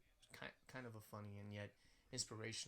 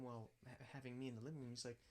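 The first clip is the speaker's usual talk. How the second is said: normal talking voice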